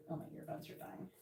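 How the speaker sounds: whispering under breath; quick whispered side remark; whispered aside. Real, not acted. Whispered aside about earbuds.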